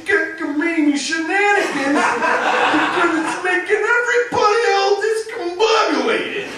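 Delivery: hammed-up bossy cop with silly words; officer voice. Officer breaking up shenanigans, pompous. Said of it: "imagine an officer".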